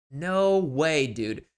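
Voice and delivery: normal talking voice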